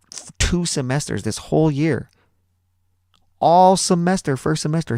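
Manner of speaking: a hint of a high, slow incredulous teacher; lightly voiced teacher; teacher defending student. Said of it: "she was like".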